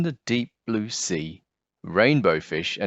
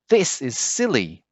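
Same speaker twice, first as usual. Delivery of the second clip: declared; 5 semitones higher than usual. Acted. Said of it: "declared Rainbow Fish".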